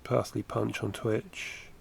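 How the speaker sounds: ordinary delivery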